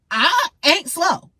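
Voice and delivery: high and slow, every word spelled out; defiant emphasis. Real, not acted. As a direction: drive it home, word by word. Defiant rant about being underestimated.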